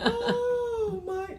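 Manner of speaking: high, choked-up exclamation; tearful. Retold or imitated. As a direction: voice the old lady's tearful surprise. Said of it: "She said".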